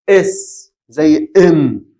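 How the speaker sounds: "اس زي ام" stretched out very slowly, each sound drawn out; slow demo. Shown as a demonstration. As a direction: draw out "اس زي ام" sound by sound. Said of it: "اول صوت خرج من دي".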